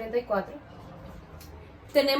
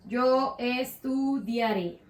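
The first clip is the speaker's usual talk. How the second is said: "Yo estudiaré" stretched out slowly; measured, slow dictation of "Yo estudiaré"; slow modelling; 3 dB quieter, much slower than usual. Shown as a demonstration. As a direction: draw out each syllable of "Yo estudiaré" for the class. Teacher models the conjugated form slowly.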